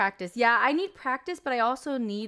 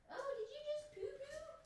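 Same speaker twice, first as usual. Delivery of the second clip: high, coo at a pet; cooing baby talk to a pet; 17 dB quieter than usual. Acted. Talking to pet.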